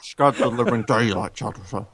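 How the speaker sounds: Sean Connery. Acted. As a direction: imitate the Scottish Bond, softly. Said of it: "See, Connery pulls those lines off".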